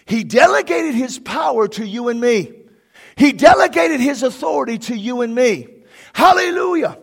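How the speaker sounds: loud, ringing pulpit preaching; shouting sermon climax; full-force preaching. Acted. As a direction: preach it out to the congregation.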